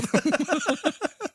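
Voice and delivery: short high burst of laughter; laughing at the joke about chewing tobacco. Real, not acted.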